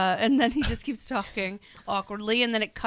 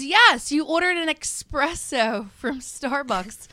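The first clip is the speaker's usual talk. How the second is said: higher, louder and slow, spelling it out; patient barista; 5 semitones higher, 5 dB louder, slower than usual. Retold or imitated. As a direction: patiently repeat the order back, slow and higher. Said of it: "And she goes".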